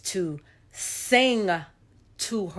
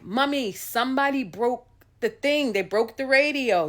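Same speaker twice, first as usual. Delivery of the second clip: put-on little girl; 6 semitones higher than usual. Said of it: "where I'm like".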